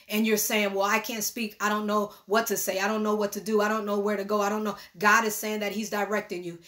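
usual speaking voice

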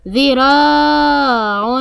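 long held aa in ذراع; ذراع said slowly with a sustained raa; elongated letter. Shown as a demonstration. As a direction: stretch the ا of ذراع right out. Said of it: "حرف الراء ممدود بالألف".

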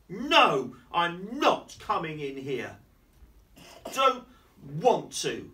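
put-on loud, stubborn child's refusal; shouting no. Parent reading, child Lulu refusing loudly in museum. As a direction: yell it like a cross little girl. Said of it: "I shouted in the museum".

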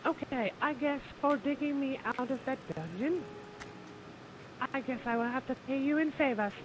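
high, loud, laughing coy offer; high flirty voice. Pretend. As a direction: offer yourself with a giggle.

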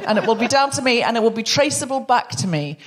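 ordinary talk

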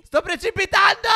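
high, loud cry while falling; alarmed shout. Real, not acted. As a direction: yell that you're going down. Dragon falling out of the sky.

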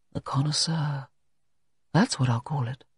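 softly muttered to himself; quiet private mutter. A narrator's reading. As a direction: mutter the title under your breath. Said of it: "muttered Alan Everard".

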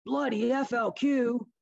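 high, slow, bitter curse from a stranger; angry bystander. Retold or imitated. Bystander at the TV curses the separatists, high and slow. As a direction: spit it out like a disgusted onlooker. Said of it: "then somebody said".

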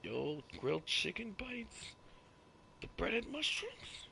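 slow, rising fast-food order; playing a customer ordering at the drive-thru; drawn-out order. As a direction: read the menu up high and slowly.

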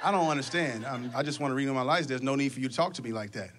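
low, hesitant, hurt customer voice; meek customer. Retold or imitated. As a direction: customer protesting the rude clerk, low and timid. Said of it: "the conversation goes something like this".

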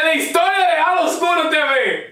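high proclaiming announcer voice; lucha libre announcer. Pretend. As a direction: proclaim it like a ring MC.

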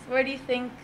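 usual speaking voice

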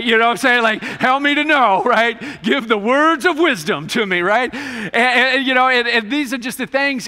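loud high-pitched preaching push; loud preaching cry to the congregation; impassioned preaching. Real, not acted. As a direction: raise it and drive it home to the room. Preaching at full force.

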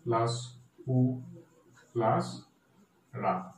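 "ত্রি", "পু", "রা" each alone with gaps; syllable by syllable. Shown as a demonstration. Teacher breaking Tripura for students, syllable by syllable. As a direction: slowly dictate "ত্রি plus পু plus রা". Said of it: "ত্রিপুরাকে ভাঙো".

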